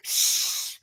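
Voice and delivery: breathy voiceless hiss like a snake; short imitated hiss. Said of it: "because snakes go".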